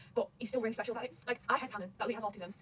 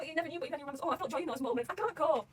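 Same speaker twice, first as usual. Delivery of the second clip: ordinary delivery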